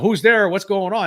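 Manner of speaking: urgent mock call into the room; retold questioning. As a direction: call out to an unknown presence, retold. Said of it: "talking to this thing saying".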